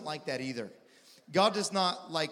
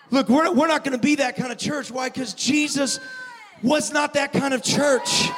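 preaching at full force; 8 semitones higher, 8 dB louder than usual. Acted.

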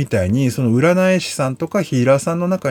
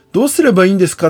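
high, louder pleading question; anxious client; 7 semitones higher, 5 dB louder, much faster than usual. Retold or imitated. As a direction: ask in a worried plea. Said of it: "って聞かれて". (不安げに問いかける声)

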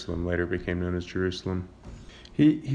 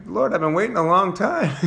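high, louder, laughing complaint to the Lord; mock impatient plea; 11 semitones higher, 7 dB louder than usual. Believer complaining to God about waiting, high laughing mock plea.

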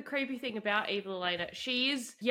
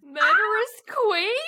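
higher and louder, delighted disbelief; thrilled gasp; 8 semitones higher, 7 dB louder than usual. Real, not acted. Fan thrilled by the pet name, loud high gasp.

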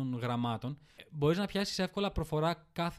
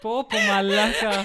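laughing; 5 semitones higher, 8 dB louder, slower than usual. Real, not acted. Laughing exclamation.

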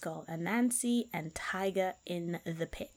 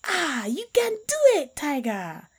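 high, loud cheering call; mock encouraging shout; 8 semitones higher, 8 dB louder than usual. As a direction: cheer him on with fake enthusiasm. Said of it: "Anansi shouted".

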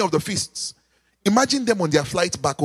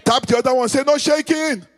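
raised, ringing preacher's call to the church; preaching; 5 semitones higher than usual. Acted. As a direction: preach it out to the congregation, high and strong. Preacher leading the congregation.

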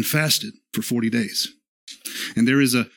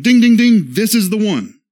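higher, louder ding-ding-ding like a flashing sign; mock neon sign announcement; 8 semitones higher, 6 dB louder than usual. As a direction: announce the winner, hammed-up and bright. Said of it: "plastering the neon sign going".

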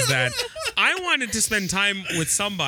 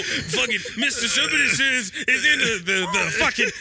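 normal talking voice

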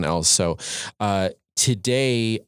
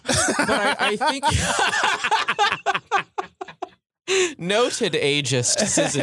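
high-pitched giggling through words; laughing. Real, not acted. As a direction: laugh while getting words out.

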